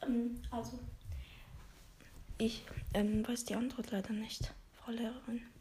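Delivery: whispered, barely audible answer. Acted. Shy pupil whispering to the teacher. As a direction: breathe it out, almost no voice. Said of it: "der, der immer richtig leise im Unterricht spricht".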